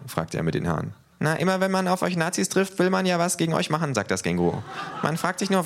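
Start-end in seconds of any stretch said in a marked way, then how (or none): 1.2-5.6 high, cheeky kangaroo voice. Acted. Kangaroo teases the neo-Nazis, high and cheeky. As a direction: needle them with a grin.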